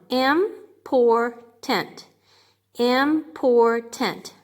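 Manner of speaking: Important stretched slow, im-por-tant; sounded out slowly. Shown as a demonstration. Teacher slowly sounding out Important for the class. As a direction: spell it out by ear, one syllable at a time. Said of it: "How many syllables?".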